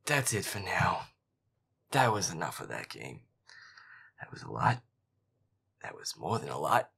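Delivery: loud, worn-out declaration; exasperated sign-off. Real, not acted. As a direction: call it quits, fed up and drained. Quitting the scary game, exhausted.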